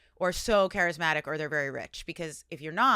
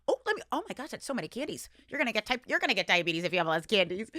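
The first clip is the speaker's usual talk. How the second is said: high, gushing candy excitement; acting out a wide-eyed reaction to candy; excited candy envy; 6 semitones higher than usual. Visiting friends and eyeing their kids' candy, acted excited gush. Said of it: "and I was like".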